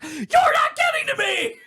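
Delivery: very high, drawn-out shriek; loud, stretched, over-the-top outburst; hammed-up scream. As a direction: scream it in defiant hysteria. Acts out monologue climax.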